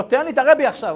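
loud, high-pitched insistence; acted urgent demand. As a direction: demand to be put through at once.

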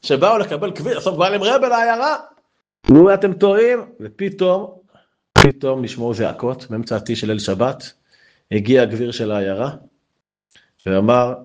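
ordinary delivery

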